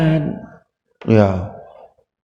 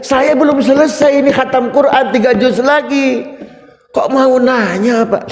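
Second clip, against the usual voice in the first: scolding angrily; 9 semitones higher than usual. Acted. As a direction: play Fatimah telling off the angels, indignant. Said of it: "Dimarahin habis-habisan". (memarahi dengan nada tinggi)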